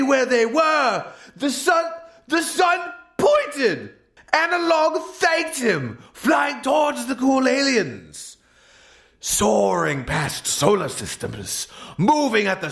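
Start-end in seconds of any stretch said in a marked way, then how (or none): none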